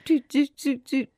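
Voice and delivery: high, even little "do" beeps; imitated skip-forward button beeps. Telling listeners to skip ahead, imitated button beeps. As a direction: mimic tapping a skip button. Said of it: "Do the little fifteen second".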